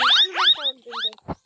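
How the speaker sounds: very high, loud squeaked question; squeaky question. Real, not acted. Asking viewers excitedly.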